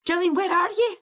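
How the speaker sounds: high, pressing question down the phone; urgent caller. Retold or imitated. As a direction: demand her location in a hurry. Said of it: "she was like".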